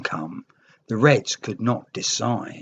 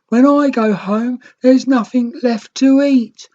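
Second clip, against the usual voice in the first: sobbing; 10 semitones higher, 5 dB louder than usual. A narrator's reading. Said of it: "The young Red sobbed".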